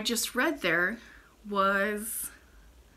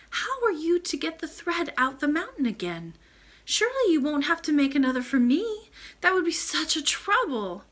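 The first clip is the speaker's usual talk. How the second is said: put-on higher girlish voice, worried and eager; a little girl fretting over the trouble she causes; higher, earnest child asking her grandmother; young princess Irene; 7 semitones higher, 4 dB louder than usual. A narrator's reading. Irene voiced in read story.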